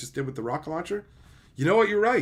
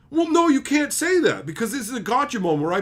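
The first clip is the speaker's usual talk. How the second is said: mock objection; 9 semitones higher, 5 dB louder than usual. Mocking the critic's objection, high and loud. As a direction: protest like an indignant critic.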